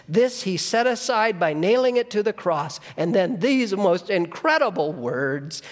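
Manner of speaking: high, loud proclamation; full-force preaching. Acted. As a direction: proclaim it triumphantly. Climax of the reading.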